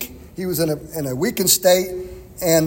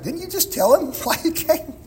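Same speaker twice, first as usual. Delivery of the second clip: higher, incredulous question with a laugh; mock disbelief; 10 semitones higher, 4 dB quieter than usual. Pastor voicing a puzzled reader, higher and amused. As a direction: poke fun at the obvious question. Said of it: "at first it's like".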